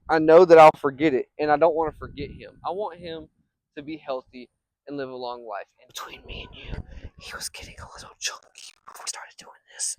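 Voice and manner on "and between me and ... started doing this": whispered aside; secret whisper. Real, not acted. Vlogger whispering a secret about his dog to viewers.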